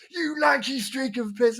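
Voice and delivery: high, drawn-out mock abuse; imitated angry insult. Van driver insults the man, hammed-up anger. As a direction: stretch the insult out in a high mimicking voice. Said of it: "the angry guy".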